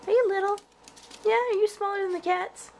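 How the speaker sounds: ordinary delivery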